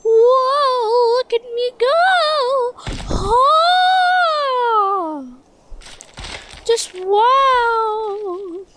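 high squeaky mock future self. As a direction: play a goofy future self pitching subscribe. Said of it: "it's me from the future".

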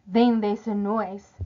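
usual speaking voice

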